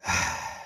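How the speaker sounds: long low unvoiced sigh; a tired sigh before speaking; deep sigh. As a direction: breathe out to calm yourself. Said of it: "took a deep breath".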